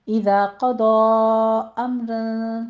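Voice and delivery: slow melodic chanted recitation; Quran recitation. Shown as a demonstration. Teacher reciting the verse for students, slow chant. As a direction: chant the verse slowly and evenly.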